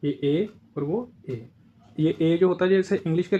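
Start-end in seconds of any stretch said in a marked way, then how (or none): 0.0-1.5 slow, drawn-out contrast of the long ए and the short ए; ए said twice, slowly, as two different vowels; two e sounds contrasted. Shown as a demonstration. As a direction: stretch out each ए so the two vowels sound apart. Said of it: "ए के ऊपर मात्रा लगाते हैं ए".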